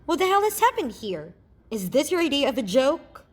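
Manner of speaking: loud, high furious demand; angry and urgent. Acted. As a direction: yell it in outrage. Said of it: "angry and urgent".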